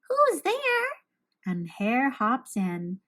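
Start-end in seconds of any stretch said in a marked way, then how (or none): 0.1-0.9 a tiny mouse calling out in alarm; startled mouse squeak; frightened mouse cry. A narrator's reading. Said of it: "Mouse cries".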